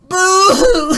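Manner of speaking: very loud, high fake sob; mocking boo-hoo wail. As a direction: blurt a big wail to make fun of crying. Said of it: "and you're like".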